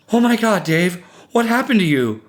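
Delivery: high, loud horrified cry; shocked, high-pitched exclamation; alarmed wife. A narrator's reading. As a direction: react aghast at someone badly hurt. Wife sees husband covered in blood.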